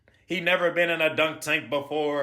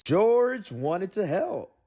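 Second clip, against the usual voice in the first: ordinary delivery, nothing marked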